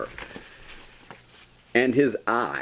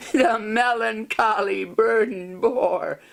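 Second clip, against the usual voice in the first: laughing; 14 semitones higher than usual. Acted. Laughing through the verse.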